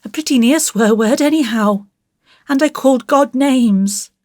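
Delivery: put-on high, breathless-rush little-boy confession; guilty child tumbling it out, pitched high. A narrator's reading. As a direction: blurt it all out like a guilty child. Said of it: "Out it all came in a rush".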